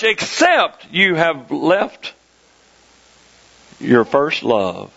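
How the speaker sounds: slow, sinking to very low; solemn rebuke. Acted. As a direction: draw it out gravely, dropping low on the last phrase. Repeats the scriptural rebuke, slowed.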